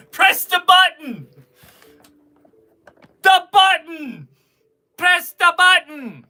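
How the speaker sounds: high, loud mock shout; imitating a yeller. Seller imitating a viewer who yells, shouting. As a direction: bellow it like the guy who always yells. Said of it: "He likes to yell".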